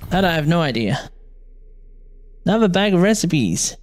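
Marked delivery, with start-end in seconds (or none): none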